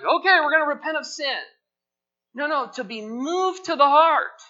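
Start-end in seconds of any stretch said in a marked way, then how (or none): 0.0-1.4 higher, quick, breezy mock reply; mock casual agreement. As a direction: toss it off lightly like it's nothing. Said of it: "everyone's like".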